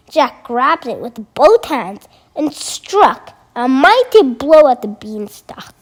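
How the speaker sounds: ordinary delivery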